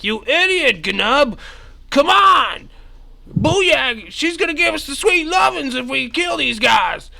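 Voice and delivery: high, loud goblin yelling at his buddy; shrill goblin shout. Acted. Goblin yelling at his friend mid-fight, high and loud. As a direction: squeal it like a frantic goblin. GM voicing goblin ally in combat.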